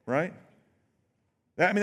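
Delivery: ordinary talk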